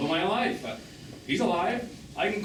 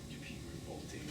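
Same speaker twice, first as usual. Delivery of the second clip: breathy whispered tease, much softer; amused whisper; 8 semitones lower, 14 dB quieter, faster than usual. Acted. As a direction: whisper it, half laughing at him. Teasing during an intimate exchange.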